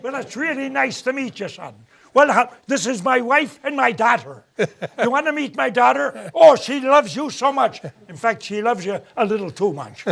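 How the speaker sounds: mock Midwestern farmer offering his daughter; Midwest accent. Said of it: "they talk like this here".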